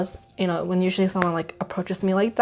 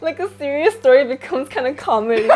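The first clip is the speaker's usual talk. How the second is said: higher, talking through laughter; real laughter breaking into the words; amused laughing; 6 semitones higher than usual. Real, not acted. Teasing friend as serious story turns comedy, laughing.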